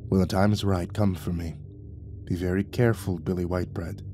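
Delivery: a quiet parting warning; murmured. Acted. Dragon murmurs a last warning to Billy, low and soft. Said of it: "The dragon murmured".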